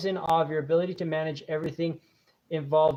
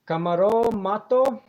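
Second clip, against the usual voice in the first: Spanish accent; 5 semitones higher, 5 dB louder, slower than usual. Shown as a demonstration. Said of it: "say your name in a Spanish accent".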